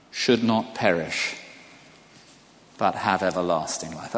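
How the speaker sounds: slow, deliberate reading of the verse. Shown as a demonstration. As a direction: let each phrase land with pauses. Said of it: "Let me read it slowly".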